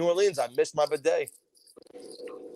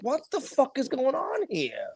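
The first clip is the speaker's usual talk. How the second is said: high, slow, softer incredulity; baffled disbelief; 8 semitones higher, 5 dB quieter, slower than usual. Real, not acted. Reacting to a gaudy Joker costume, incredulous.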